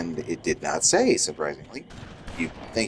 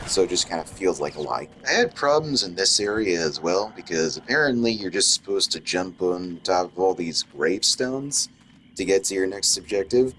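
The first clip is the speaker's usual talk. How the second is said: ordinary talk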